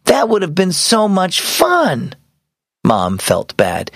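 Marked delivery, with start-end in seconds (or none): 0.0-2.1 wail. A narrator's reading. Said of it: "Aubrey wailed".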